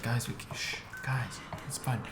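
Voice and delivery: hushed, half-whispered shushing of friends; hushing. Retold or imitated. Teacher's pet quietly shushes rowdy friends, hushed.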